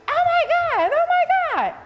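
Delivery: high, loud panicked cry; alarmed oh my god. Retold or imitated. Assistant panicking as she spots him. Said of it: "she was like".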